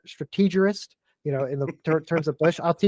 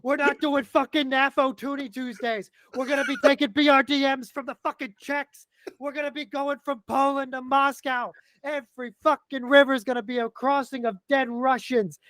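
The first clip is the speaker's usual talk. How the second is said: hyped rant; 6 semitones higher than usual. Real, not acted. Hyped pro-war rant.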